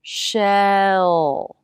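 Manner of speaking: "Shell" with long held dark L. Shown as a demonstration. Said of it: "Notice how the jaw relaxes".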